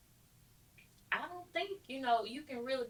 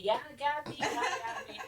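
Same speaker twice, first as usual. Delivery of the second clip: imitating mom, laughing and higher; laughing quote; 7 semitones higher than usual. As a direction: retell her happy laugh. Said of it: "She said".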